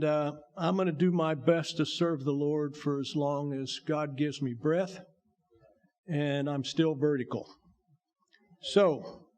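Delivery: ordinary delivery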